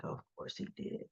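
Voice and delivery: very soft, voiceless aside; muttered aside. Retold or imitated. Recapper voicing his reply to the news, hushed aside. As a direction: half-whisper it under the breath. Retells man's resigned aside.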